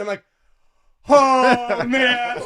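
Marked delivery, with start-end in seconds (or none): 1.1-2.4 high, loud, drawn-out "oh man" reaction; shocked outcry. Retold or imitated. Man reacts to his date switching accents, shocked loud outcry. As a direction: cry out in mock disbelief, loud and high.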